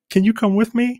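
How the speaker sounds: high-pitched pleading question, as quoted; imitating wife. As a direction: ask it high, like a wife coaxing. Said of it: "she was like".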